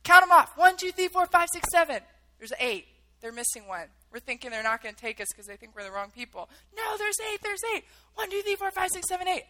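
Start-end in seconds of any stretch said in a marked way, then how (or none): 0.0-2.0 loud, higher-pitched headcount; retold shouting. Soldier counting captives beside helicopters, retold loud shout. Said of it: "screaming 'cause it's so loud". 6.7-9.4 high-pitched pleading count, retold; urgent protest. Captives correcting the soldiers' headcount, high urgent retold protest.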